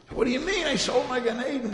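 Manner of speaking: high, loud outraged cry; screaming in outrage. Retold or imitated. Dead husband screaming at the butcher in outrage. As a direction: shout it like a man robbed of his reward. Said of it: "he starts screaming away".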